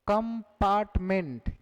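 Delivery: "कंपार्टमेंट" split into three, कं, पार्ट, मेंट; syllable by syllable. Shown as a demonstration. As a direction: separate each syllable of "कंपार्टमेंट" for counting. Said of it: "कितने syllable दिखे?". ("कंपार्टमेंट" को तीन syllable में अलग-अलग बोलकर)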